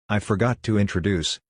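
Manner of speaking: plain reading voice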